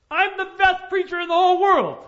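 high, full-volume boastful holler; bold yell. Acted. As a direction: yell it boldly across a canyon. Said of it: "so he yells out again".

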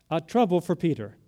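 usual speaking voice

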